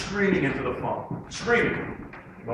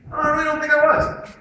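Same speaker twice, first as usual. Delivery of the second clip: higher, louder mock insistence; acted protest; 12 semitones higher, 8 dB louder than usual. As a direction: protest innocence, pitched up. Acting someone protesting their innocence.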